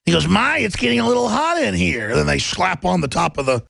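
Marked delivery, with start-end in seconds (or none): 0.3-2.1 loosely imitated cartoon character; rough, lilting go at a cartoon voice; Bugs Bunny. Bugs Bunny in the heating cauldron, a rough imitation. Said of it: "Bugs Bunny imitation of that Brooklyn accent".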